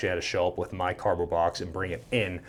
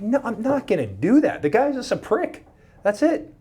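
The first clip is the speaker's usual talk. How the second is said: indignant refusal; 6 dB louder than usual. Retold or imitated. Refuses sergeant's request to apologize.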